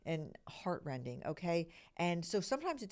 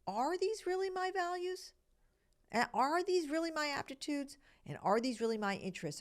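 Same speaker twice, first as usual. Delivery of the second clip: questioning yourself; 10 semitones higher than usual. Retold or imitated. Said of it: "you gotta go into this period of investigation, like".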